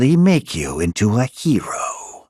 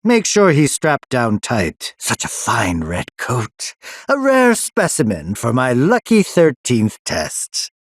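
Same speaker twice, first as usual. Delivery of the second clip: ordinary delivery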